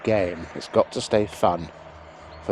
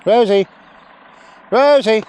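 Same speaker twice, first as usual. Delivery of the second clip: high, loud shout of the dog's name; calling out; 13 semitones higher, 8 dB louder than usual. Real, not acted. Trainer shouting the dog's name across the field.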